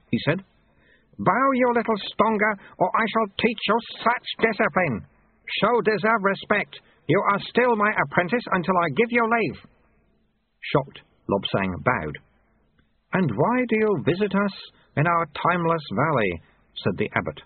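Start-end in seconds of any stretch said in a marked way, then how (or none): 1.2-9.6 high, urgent character voice; old master telling off his pupil; scolding sweeper master. A narrator's reading. 13.1-16.4 high-pitched abbot voice; raised-pitch holy man; questioning abbot. A narrator's reading.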